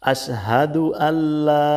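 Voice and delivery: slow, careful Arabic recitation, each syllable drawn out. Shown as a demonstration. Teacher reciting Arabic slowly for learners. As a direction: recite it slowly for students to copy.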